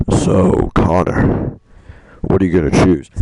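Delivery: loud, breathy villain taunt; menacing whisper. Retold or imitated. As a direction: push him to decide, looming. Kamsky pressing Connor.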